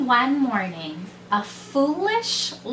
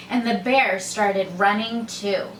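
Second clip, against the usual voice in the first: ordinary delivery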